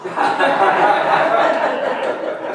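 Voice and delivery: breathy laughing burst; burst of laughter. Real, not acted. Preacher laughing at his own punchline, breathy laugh. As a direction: break into laughter.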